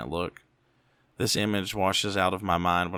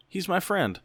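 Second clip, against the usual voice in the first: put-on much higher, cheerful and childlike; 11 semitones higher than usual. A narrator's reading. Muzzled mother introduces the demon to her son, cheerful. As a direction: chirp it like a happy simple child. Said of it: "she says cheerfully".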